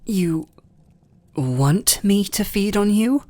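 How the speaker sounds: can't believe what he's hearing; incredulous question. Acted. Incredulous at the offer.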